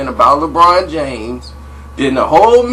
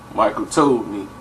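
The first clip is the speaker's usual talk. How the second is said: ordinary talk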